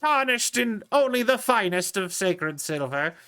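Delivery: mock biblical prophet. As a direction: bless the cannon like a priest. Continues holy warrior role.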